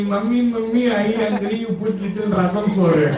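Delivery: hammed-up hungry child; whiny child voice. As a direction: beg mummy for food like a kid. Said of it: "ask the mummy".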